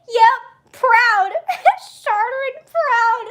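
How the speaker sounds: high, loud, through giggles; giggly mock-proud declaration; laughing. Real, not acted. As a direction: squeeze the words out between laughs. Jokes on, still laughing.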